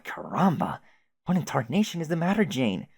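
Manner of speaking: ordinary talk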